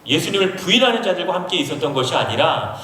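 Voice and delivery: ordinary talk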